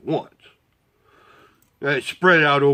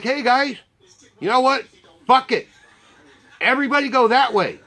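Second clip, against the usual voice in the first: raised-pitch address to a crowd; retold fed-up announcement, pitched high; calling out orders; 8 semitones higher than usual. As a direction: round up a group of riders. Retold calling out to waiting riders.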